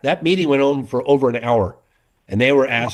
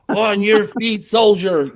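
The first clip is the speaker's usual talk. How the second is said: higher, raised mock drill sergeant command; drill sergeant order; 8 semitones higher, slower than usual. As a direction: bark it at a worn-out soldier. Hauling exhausted friend up mountain.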